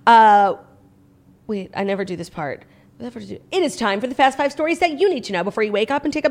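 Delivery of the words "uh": loud crunching noise; toast crunch sound. Real, not acted. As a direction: bite into toast on mic. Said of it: "what the hell was that".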